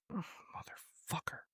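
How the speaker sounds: a breathless, unvoiced whisper of a swear; whispered curse. Coach to himself as his wrestler gets pinned, a whispered curse. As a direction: hiss the curse under your breath, barely audible.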